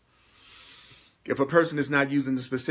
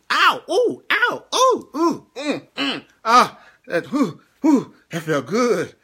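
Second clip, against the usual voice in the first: ecstatic whoops; 9 semitones higher, 6 dB louder, slower than usual. Real, not acted. Ecstatic after viewers sent hearts.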